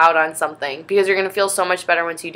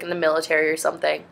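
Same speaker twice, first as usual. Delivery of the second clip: ordinary talk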